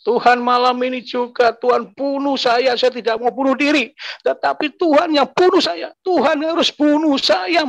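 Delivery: high, strained plea to God; angry prayer. Retold or imitated. Man demands that God end his life tonight, angry prayer. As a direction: cry it out to heaven, pitch way up. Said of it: "Dan pada itu saya marah".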